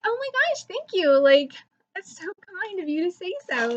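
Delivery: high, swooping re-enacted gratitude; flattered gushing. YouTuber thanking adoring fans, acted-out gushing.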